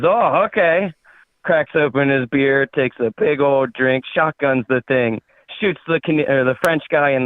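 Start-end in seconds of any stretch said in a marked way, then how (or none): 0.0-0.9 put-on higher-pitched, agreeable Canadian accent. Canadian accepts the French guy's explanation, Canadian accent. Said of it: "that's a pretty spot-on Canadian".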